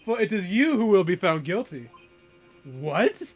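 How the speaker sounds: pompous, booming tycoon sneer; haughty villain voice. Acted. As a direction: lord it over a lowly lawyer.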